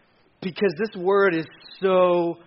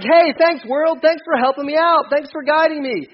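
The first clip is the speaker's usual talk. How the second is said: high, loud gullible thanks; hammed-up eager thanks; mock gratitude; 8 semitones higher, 4 dB louder than usual. People thanking the world for its advice, mock-eager. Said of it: "and we're like".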